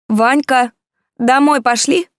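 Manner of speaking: high, loud shrill yell; shrill wife's voice. A narrator's reading. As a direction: screech at him like a nagging wife. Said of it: "раздался визгливый голос его жены".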